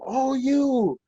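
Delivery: grieving grandma won over by the joke, high and loud; laughing grandma. Retold or imitated. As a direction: play the grandma laughing through tears, pitched high. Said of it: "she goes".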